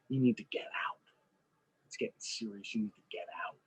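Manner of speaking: mock urgent whisper. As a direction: whisper the warning to get out. [name] urgently sends friends away.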